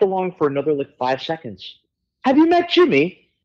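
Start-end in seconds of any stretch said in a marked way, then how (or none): 2.2-3.3 high, bright English gentleman voice. Retold or imitated. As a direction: introduce a friend like a gracious Brit. Plant quoted in story.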